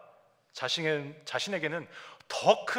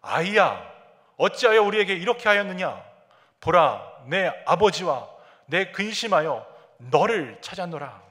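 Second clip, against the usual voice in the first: mother's rebuke read out; 4 semitones higher, 9 dB louder than usual. Retold or imitated. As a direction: declaim the worried mother's reproach. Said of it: "그의 어머니는 이르되". (근심한 어머니의 꾸짖음을 크게 낭독)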